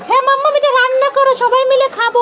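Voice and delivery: put-on high, quick little-kid voice; excited child figure. Toy child asking mamma to cook, in a quick high voice. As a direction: rattle it off high and eager.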